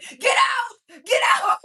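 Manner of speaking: high, loud, screaming at him to leave; furious yell. Retold or imitated. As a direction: lose it completely and scream him out. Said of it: "literally loses his stuff".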